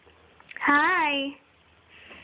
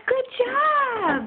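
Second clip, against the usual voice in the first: excited praise; 10 semitones higher than usual. Real, not acted. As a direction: gasp then high, bright praise. Mom praising toddler answer.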